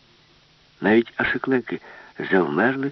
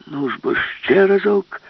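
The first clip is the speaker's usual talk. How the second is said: loud, higher, slow rallying yell; shout; 11 semitones higher, 6 dB louder, slower than usual. Acted. Professor shouts to Alice to strike again. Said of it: "закричав професор".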